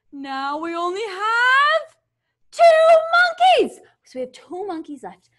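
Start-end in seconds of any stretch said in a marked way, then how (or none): 0.1-3.8 dismayed. Pretend. Mock dismay counting monkeys.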